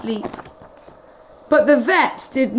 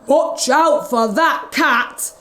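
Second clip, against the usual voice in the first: slow, lightly raised warning; mild mock shout; 3 semitones higher, slower than usual. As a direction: call out a warning. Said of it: "shouted the vet".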